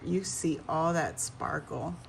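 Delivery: normal talking voice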